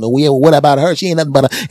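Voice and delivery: high, loud retort; catty comeback. Retold or imitated. Lady B hitting back at Lady A, high and catty. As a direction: fire back with gossip. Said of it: "Lady B does exactly the same".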